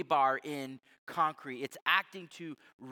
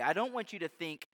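ordinary talk

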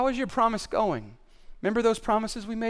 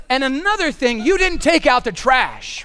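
loud, higher-pitched acted grievance; mock nagging spouse; 5 semitones higher, 6 dB louder than usual. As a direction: hammer out the complaint, dragging the ending. Acted spousal argument listing grievances.

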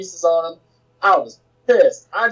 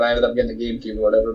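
ordinary delivery

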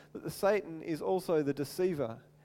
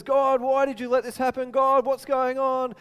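pleading cry to God; 5 semitones higher, 4 dB louder than usual. Retold or imitated. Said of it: "Moses kinda cries out to God".

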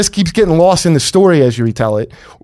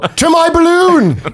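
slow, high grand exit; hammed-up aristocrat flourish; old-timey gentleman; 11 semitones higher, much slower than usual.

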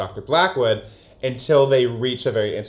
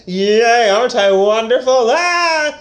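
higher, louder self-satisfied squeal; smug glee; 11 semitones higher, 7 dB louder, slower than usual. Retold or imitated. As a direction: gloat with hammed-up glee. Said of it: "he's like".

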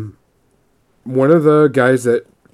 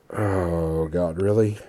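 low, hushed exasperation; quiet retold dismay; weary disbelief; 7 semitones lower, 9 dB quieter, much slower than usual. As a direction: say it under your breath, sinking with disappointment. Weary at another Hobbit riddle.

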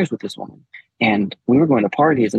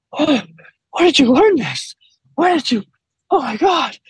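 high, excited mimicked wife voice; wife's high gasping praise, imitated; wife's quote; 12 semitones higher than usual. Husband imitating his wife's amazed reaction, high-pitched. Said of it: "And she's like".

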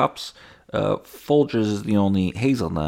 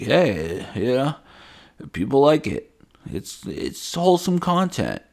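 higher, rising defensive whine; 7 semitones higher than usual. Retold or imitated. Influencer defending content to family, higher whine. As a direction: justify yourself, voice climbing. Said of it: "And you're like".

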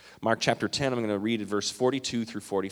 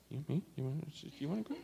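soft, quick, murmured offer; barely voiced, quick polite murmur; quietly waving the next person ahead; hushed polite offer; 3 semitones higher, 15 dB quieter, much faster than usual. Politely offering his place in line.

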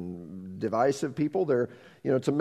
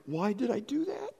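higher and softer, a touch of despair; mock self-reproach; 9 semitones higher, 7 dB quieter than usual. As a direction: ask yourself half-lamenting. Acted self-reproach in mind.

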